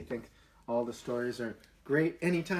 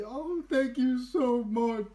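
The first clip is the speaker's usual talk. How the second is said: grateful; 9 semitones higher, slower than usual. Acted. Rescued animals thanking her.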